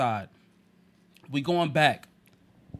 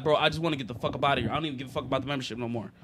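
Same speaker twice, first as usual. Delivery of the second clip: fast fed-up mutter; 5 semitones lower, 4 dB quieter, much faster than usual. Retold or imitated. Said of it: "I'm like".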